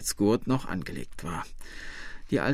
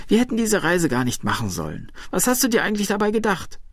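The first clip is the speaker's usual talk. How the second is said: higher, fast, accusing questions; reproachful scolding; 8 semitones higher, faster than usual. A narrator's reading. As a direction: scold him in quick, raised pitch. Wife reproaches husband after the accident. (vorwurfsvoll schimpfend)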